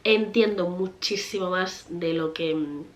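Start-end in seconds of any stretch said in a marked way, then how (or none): none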